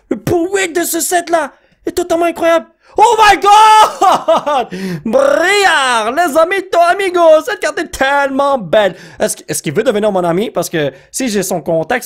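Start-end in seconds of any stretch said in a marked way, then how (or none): 0.1-2.7 high-pitched excited gush; amazed raving. Real, not acted. Card opener raving about the pull rate, amazed squeal. 3.0-9.0 long high shout of amazement; emotional excitement. Real, not acted. Card opener finding a beautiful card, emotional ecstatic shout. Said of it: "je suis émotionnel en ce moment".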